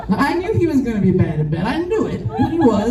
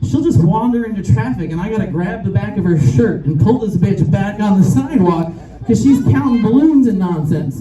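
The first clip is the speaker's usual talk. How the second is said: ordinary delivery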